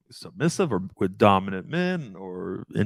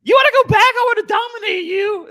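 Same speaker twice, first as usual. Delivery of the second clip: squeaky very high and louder; hammed-up timid guy gushing; 7 dB louder than usual.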